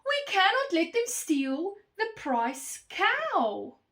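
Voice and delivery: high, loud rallying cry; loud protest. A narrator's reading. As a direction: call it out slowly and emphatically. Animals protest together against theft.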